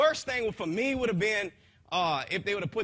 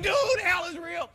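urgent shout; 11 semitones higher, 5 dB louder than usual. Retold or imitated. Insisting to the paramedic.

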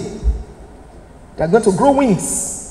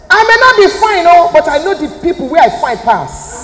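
loud, high Nigerian Pidgin comeback; Nigerian Pidgin retort; 4 semitones higher, 4 dB louder than usual. Retold or imitated. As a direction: fire back in Nigerian Pidgin with sass. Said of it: "reply you in pidgin".

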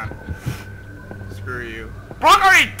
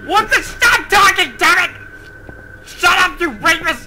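high, raised-voice exasperation; angry yelling; 7 semitones higher, slower than usual. Real, not acted. Yells at the game monster.

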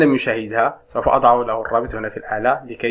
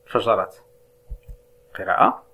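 normal talking voice

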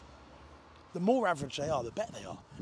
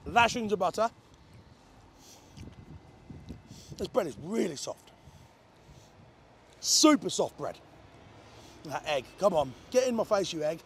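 muffled chewy speech; chewing while talking; 2 semitones lower than usual. Real, not acted. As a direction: mouth partly full of bread. Seen 0: Bit sandwich at -7; jaw and cheeks look like chewing throughout.

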